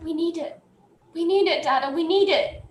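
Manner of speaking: put-on high, small child voice, upset; acted tearful pleading. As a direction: plead like an upset kid to a parent. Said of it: "he's been crying this entire time".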